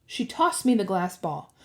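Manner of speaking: ordinary delivery, nothing marked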